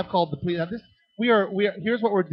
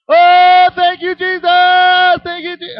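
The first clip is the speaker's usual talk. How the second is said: high, loud rapturous cry of praise; ecstatic church lady; 14 dB louder, slower than usual. Retold or imitated. Pastor's son imitating a lady shouting praise mid-sermon. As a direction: throw your hands up and cry out to Jesus. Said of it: "stick her hands up like this and go".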